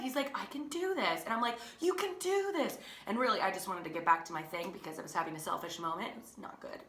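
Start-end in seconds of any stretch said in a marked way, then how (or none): 0.4-1.2 imitating an excited little brother, higher pitch; nervously excited. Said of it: "he was kind of excited". 1.8-2.8 high-pitched pep talk; encouraging cheer. Retold or imitated. Sister cheering brother on.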